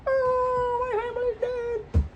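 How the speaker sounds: very high squeaky cartoon voice for the grieving son; squeaky high Michael voice. Kid retelling game lore squeaks Michael's grief. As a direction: pitch way up into a tiny squeak.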